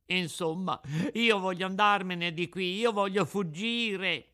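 high, loud, wilful cry; insistent puppet outburst. Acted. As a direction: insist as a desperate little puppet.

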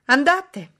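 high, loud order; shouted command. A narrator's reading. Lady sending the hesitant footman off, shouting. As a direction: shout the servant on his way. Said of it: "gridò la signorina Ingram".

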